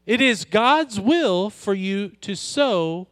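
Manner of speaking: ordinary talk, nothing marked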